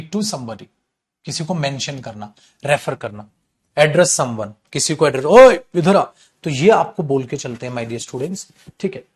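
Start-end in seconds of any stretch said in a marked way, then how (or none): 5.2-6.0 loud call across the room, louder. Acted. Teacher calls someone over, a loud rough shout. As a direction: shout it to get someone's attention.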